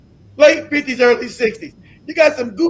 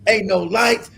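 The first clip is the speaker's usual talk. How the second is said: ordinary talk, nothing marked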